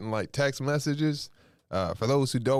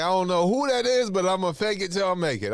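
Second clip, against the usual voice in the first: much higher, louder mock cheer; bluffing excitement; 9 semitones higher, 4 dB louder than usual. Host pretends to recognize the caller, higher, louder mock cheer. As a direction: fake it brightly. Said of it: "I was like".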